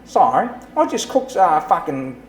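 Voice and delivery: broad Aussie, cheerful and rough; hammed-up Aussie breakfast story; Aussie accent. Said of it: "That is very good".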